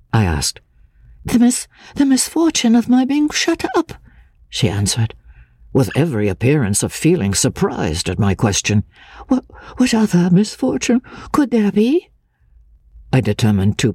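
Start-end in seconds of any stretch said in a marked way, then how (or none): 1.2-3.9 put-on high, hesitant, frightened girl's voice; a nervous fragile woman, stammering; lightly breathy, fearful young woman; timid young woman. A narrator's reading. Anne's voice in dialogue. 9.3-12.1 put-on high, hesitant, frightened girl's voice; a nervous fragile woman, stammering; lightly breathy, fearful young woman; timid young woman. A narrator's reading. Anne's voice in dialogue.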